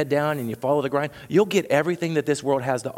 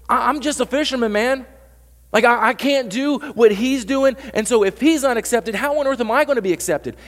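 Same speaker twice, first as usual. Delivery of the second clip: imitating Peter freaking out; 8 semitones higher, 3 dB louder than usual. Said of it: "having this holy freak out".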